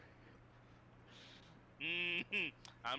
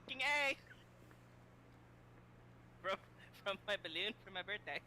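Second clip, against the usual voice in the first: helium voice; 4 semitones higher than usual. Real, not acted.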